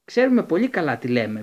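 loud angry retort; roaring. A narrator's reading. Harry roaring back at Snape, loud and angry. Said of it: "βρυχήθηκε ο Χάρη".

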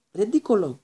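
loud, high yell of the charm; yelled spell. A narrator's reading. Parvati yelling the spell at the mummy. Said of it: "κράβγασε η Παρβάτη".

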